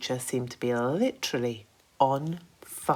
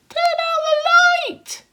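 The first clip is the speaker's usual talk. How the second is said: high and louder centipede call; raised voice; 12 dB louder than usual. Acted. Centipede calling to the glowworm, voice raised. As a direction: raise the voice to be heard across the room. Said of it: "raising his voice".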